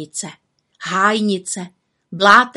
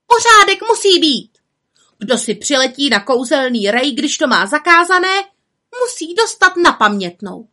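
witches shouting; 7 semitones higher, 6 dB louder than usual. A narrator's reading. Witches demanding order.

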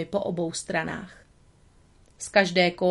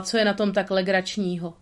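plain reading voice

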